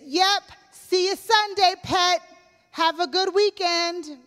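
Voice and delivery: high, sing-song granny reply; cheery Nana. Retold or imitated. Nana's expected friendly goodbye, a light high sing-song. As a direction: lightly voice a chirpy grandmother. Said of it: "what I expected was for Nana to say".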